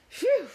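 loud, high "phew"; exaggerated sigh of relief; relieved sigh. A narrator's reading. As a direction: let out a big whew of relief. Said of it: "Cow sighed".

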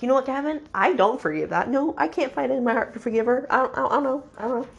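mildly acted exasperated refusal; exasperated retort. Her own retort to Gavin.

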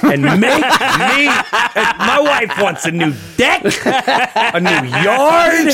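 high laughing squeal of a joke; giggling through a teasing punchline. Real, not acted. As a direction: laugh the words out high and slow. Joking about wishes for money.

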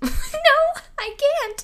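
high, loud laughing answer. Real, not acted. Podcaster answering her own question about first laughing, laughing. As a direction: squeak it out through a real laugh.

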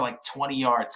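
usual speaking voice

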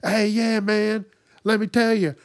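loud, high mock holler; yelling across the room. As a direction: imitate a loudmouth calling across a room. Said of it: "not yelling across the room".

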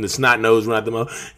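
normal talking voice

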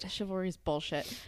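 soft voiceless whisper. Acted. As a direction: lean in and whisper it. Whispered reply echoing the aside.

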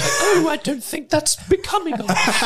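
high, prim upper-class lady; old British lady voice. Retold or imitated. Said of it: "I want more old British lady voice from you".

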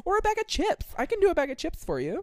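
high, quick worker offer; eager worker. Retold or imitated.

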